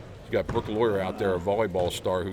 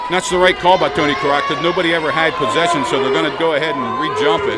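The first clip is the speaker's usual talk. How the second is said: fast sports commentary; 5 semitones higher, 3 dB louder than usual. Acted. Live play-by-play of a game.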